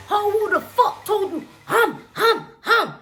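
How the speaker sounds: high hammed-up movie fight voice; imagined brawl scene, hammed-up and high; mock movie fight.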